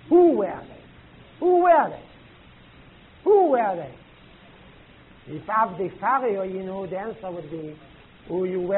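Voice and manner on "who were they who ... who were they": insistent repeated question. Real, not acted. Teacher pressing the class.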